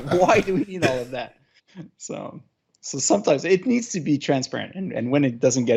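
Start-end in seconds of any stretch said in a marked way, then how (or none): 0.1-1.4 laughing, higher mock wife; wife's voice. Husband mimicking wife doubting the gadget, laughing. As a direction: tease her skeptical question through a laugh.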